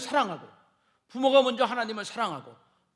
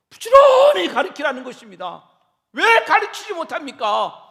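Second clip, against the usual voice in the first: preaching shout; 5 semitones higher, 13 dB louder than usual. Acted. Preacher exhorting parents.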